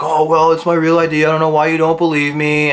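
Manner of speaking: lightly voiced defensive kid; mock defensive kid. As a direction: imitate him insisting, slightly whiny. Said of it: "He's like".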